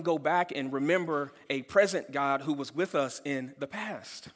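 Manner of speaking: ordinary talk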